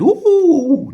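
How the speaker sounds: high frightened ooh yelp; a sudden scared squeal; fearful ooh. Retold or imitated. The boy realises he's in trouble, squealing ooh. Reacting in fear.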